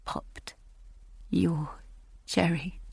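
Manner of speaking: a stunned, sickened whisper; whispered echo. Acted. Julia repeating Robbie's crude words to herself in a whisper. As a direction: breathe the words in disgust, barely voiced. Narrator echoes his words.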